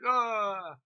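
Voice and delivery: loud, drawn-out wince; slow, loud yelp of disgust; pained cry. Real, not acted. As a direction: wince out loud at the gross scene. Reacting to hand in disposal.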